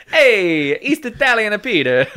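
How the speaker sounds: a loud, high, cartoon Italian-American mamma; Italian-American accent. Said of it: "I can do this accent forever".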